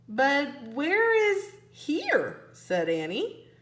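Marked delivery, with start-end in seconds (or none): 0.1-2.4 high, slow wondering question; drawn out like a puzzled little girl; curious girl. Acted. Annie asking Jack, high and wondering.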